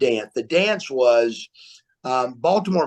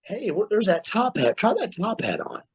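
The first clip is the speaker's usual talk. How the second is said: higher-pitched voice for the wife; lightly put-on, pitched-up wife's voice; imitating wife; 6 semitones higher, 3 dB quieter than usual. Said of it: "my wife says".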